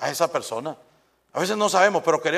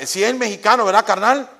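Mexican lilt, louder and higher; mock Mexican slang; 7 semitones higher, 8 dB louder than usual. Shown as a demonstration. Preacher playfully mimicking a Mexican friend, Mexican accent. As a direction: toss off carnal like a Mexican buddy. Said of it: "no estoy hablando mexicano".

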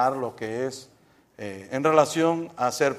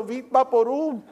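a hint of a cold-stricken cheer; 10 semitones higher, slower than usual. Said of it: "tengo una gripe".

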